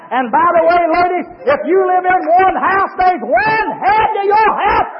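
high, loud, rising sermon cadence; shouted hellfire-and-brimstone delivery; full-force preaching. Acted. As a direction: preach it hard to the whole church. Preacher whips up congregation.

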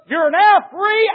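high, slow shout of freedom; triumphant shout. Acted. The master declaring the slaves free, a slow, high shout. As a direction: proclaim it to the whole church.

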